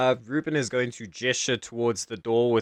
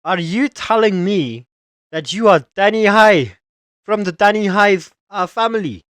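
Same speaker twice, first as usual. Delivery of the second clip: high, loud stunned disbelief; can't believe who he's facing; shocked guard; 7 semitones higher, 7 dB louder than usual. Acted. Guard learns the thief is Coin Master, shocked shout.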